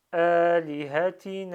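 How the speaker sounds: "Alihatina" loud and slow, long first and last vowel; two prolonged vowels. Shown as a demonstration. Teacher shows lengthening here and at the end, "Alihatina" drawn out. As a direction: stretch the opening and closing a of "Alihatina". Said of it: "un prolongement ici et un prolongement à la fin".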